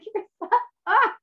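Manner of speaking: sudden high laugh; high burst of laughter. Real, not acted. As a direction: laugh out loud, real. Laughing at husband's joke.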